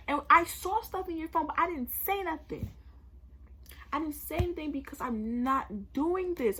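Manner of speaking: loud, high accusing outburst; angry confrontation. Retold or imitated. Girlfriend confronting cheating boyfriend in front of friends, angry. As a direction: retell a furious break-up, driving each point home. Acted break-up over cheating.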